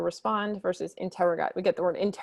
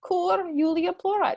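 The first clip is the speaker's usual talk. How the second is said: higher, worried mother asking why; 10 semitones higher than usual. Acted. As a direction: ask like a concerned mom. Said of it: "She noticed her daughter is crying".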